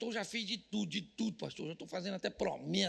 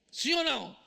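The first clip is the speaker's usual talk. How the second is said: "Sim ou não?" pushed louder, demanding an answer; louder repeat; 4 semitones higher, 7 dB louder than usual. Shown as a demonstration. Pastor demanding an answer from the congregation, louder.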